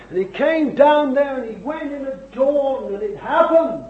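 urgent, forceful sermon delivery; fervent preaching. Real, not acted. Preacher urging young people to forsake everything, fervent preaching. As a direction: preach it hard, driving each point home.

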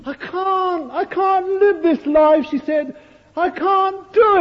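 high, loud, slow sobbing cry of despair; sobbing plea. Retold or imitated. Preacher voicing a weeping woman before the Lord, high anguished sobbing. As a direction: voice a broken woman sobbing to God. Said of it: "sobbing her heart out".

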